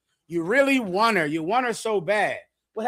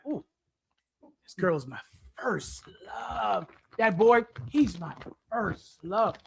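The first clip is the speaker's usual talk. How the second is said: whispered, dreamy mimic of a lovestruck kid; 6 dB quieter than usual. Mocking the young, a lovestruck whisper. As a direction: breathe it like a swooning teenager.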